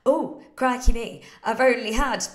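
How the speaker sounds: playful "oh crikey" alarm; mock dismay. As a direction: act out suddenly noticing you're behind. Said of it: "you might look at it on your desk thinking".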